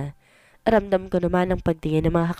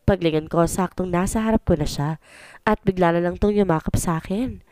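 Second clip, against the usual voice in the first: ordinary delivery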